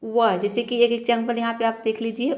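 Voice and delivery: usual speaking voice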